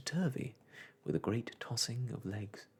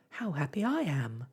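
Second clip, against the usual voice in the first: Michael's happy cry; 4 semitones higher, 5 dB louder than usual. A narrator's reading. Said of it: "cried Michael".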